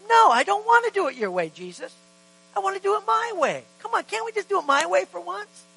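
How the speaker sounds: high, loud, pleading complaint; whiny protest. Retold or imitated. A believer arguing with Jesus, loud whiny protest. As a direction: beg like a stubborn kid wanting his way.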